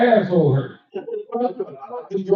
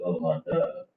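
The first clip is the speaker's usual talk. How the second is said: normal talking voice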